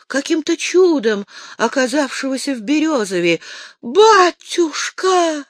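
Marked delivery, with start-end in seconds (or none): 3.8-5.5 loud, slow, high cry of recognition; shout. A narrator's reading. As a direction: call out to father across the yard. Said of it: "вскричал Митя". (громкий протяжный возглас «Батюшка!»)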